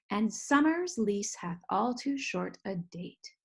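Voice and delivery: Shakespeare read in a marked iambic beat; metrical weak-strong reading. Shown as a demonstration. Teacher reading a sonnet line for students to follow, stressed metrical beat. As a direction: scan the verse aloud, weak then strong. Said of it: "we probably wouldn't read that in that exact way".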